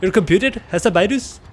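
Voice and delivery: hammed-up Indian accent. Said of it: "read it in an Indian accent".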